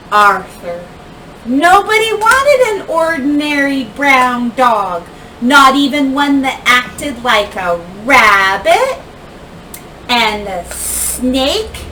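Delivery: ordinary delivery, nothing marked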